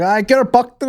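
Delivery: high, bright Dutch man's Get her bucked; a Dutch guy parroting local slang; Dutch-tinged Get her bucked. Retold or imitated. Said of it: "He's like".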